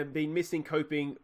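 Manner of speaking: ordinary talk, nothing marked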